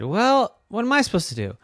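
a hint of a puzzled lizard; iguana voice. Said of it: "And the iguana said".

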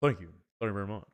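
lightly voiced rock-and-roll King; playful showman impression. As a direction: play the crooner thanking fans. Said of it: "that was a pretty good [name]".

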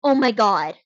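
loud, stunned protest; shocked disbelief. Real, not acted. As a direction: refuse to believe the awful news. Hears breaking news of death.

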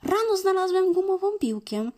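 high, yappy puppy voice calling to play; barking puppy. Acted. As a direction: bark it out like an eager little dog. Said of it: "Piesek zaszczekał".

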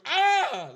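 sudden high loud yelp of pain; mock yelp. Yelling out to show how he screamed when bitten, a sharp high yelp. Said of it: "All you heard was".

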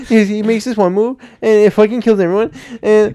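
high, loud, fast retelling; excited. Retold or imitated. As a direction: gush about it like a fan. Mocking friend's excited explanation.

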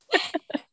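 short, high laugh. Real, not acted. Guest amused by her own story, short laugh. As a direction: let out a brief amused laugh. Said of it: "and you're laughing".

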